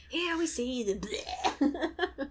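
mock a slow documentary voice-over dying mid-line; David Attenborough. Joking about a narrator hit by a snowmobile, slow mock documentary voice. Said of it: "scraped David Attenborough off the front".

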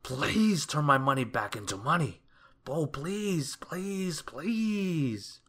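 high, desperate pleading; begging. A narrator's reading. Mister Mean begging the wizard for his money, desperate. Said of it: "he begged".

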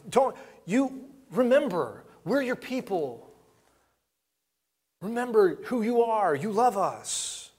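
high, slow tender plea; Moses pleading. Retold or imitated. Moses pleading with God to remember his people, high and slow. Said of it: "Moses is pleading".

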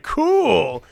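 high, loud, drawn-out cool; big impressed reaction, played up; retold excitement. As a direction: exclaim it in mock delight. Retold delighted reaction to rosary offer.

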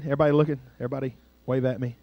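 ordinary delivery

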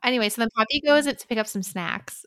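ordinary delivery

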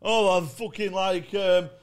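smug mocking voice. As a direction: ham up a smug open spot. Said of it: "they start going".

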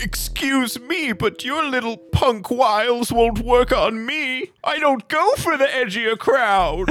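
very high, affronted falsetto; indignant cab driver. Acted. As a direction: rebuff a flirt as a prissy cabbie, shrilly high. Cab driver rejects the charm attempt.

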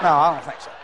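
low-pitched retold refusal; householder declining. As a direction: turn them down, voice dropped low. Householder turns down dull visitor.

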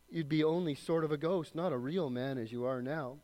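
dramatic read-aloud of a story passage. Acted. Preacher reading a story passage to the congregation, dramatic read-aloud. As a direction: read it like a storyteller, lifting the lines.

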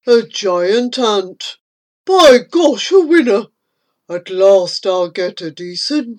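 hungry anteater voice; greedy creature talking to itself; mumbling creature. A narrator's reading. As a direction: gloat hungrily to yourself. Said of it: "licked its starving lips and mumbles".

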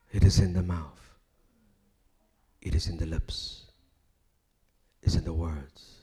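hushed preaching. Acted. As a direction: drop down and draw them in. Quiet, low preaching passage.

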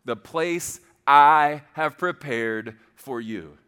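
"I" hit loud and long, then "have prepared for you" slowly; emphatic repetition. Shown as a demonstration. Repeats verse to be underlined.

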